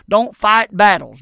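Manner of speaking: slow, loud, each word hit; emphatic warning. Real, not acted. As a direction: drive the warning home hard. Preacher hammering point.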